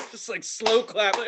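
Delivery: ordinary talk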